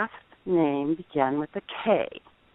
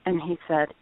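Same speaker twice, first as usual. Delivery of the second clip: ordinary talk, nothing marked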